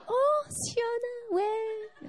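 cheerful mom. Retold or imitated. Mom answering the phone while golfing, cheerful and sing-song. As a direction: sing it out like a happy mother on the golf course. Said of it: "she was in such a good mood".

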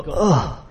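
mock "ugh" of disgust; cringing groan. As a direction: groan as if cringing at a bad sight. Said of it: "didn't it just make you go".

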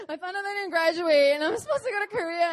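student begging the professor, crying; tearful plea. Retold or imitated. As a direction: pour out the panic near tears. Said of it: "pouring it all out".

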